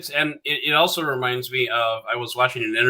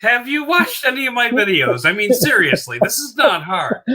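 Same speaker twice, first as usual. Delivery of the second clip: high, incredulous, half-laughing protest; laughing exasperation; mock exasperation; 8 semitones higher than usual. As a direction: object in amused disbelief, pitched up. Retold exasperated reply, half laughing.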